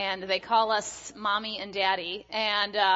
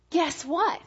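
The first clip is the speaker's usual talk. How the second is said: put-on eager little boy; 8 semitones higher, 4 dB louder than usual. Son voiced in story.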